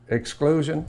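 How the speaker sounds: ordinary talk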